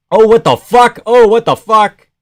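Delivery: loud, high disbelief; shocked outburst. Real, not acted. Watcher stunned by the fight, shocked shout.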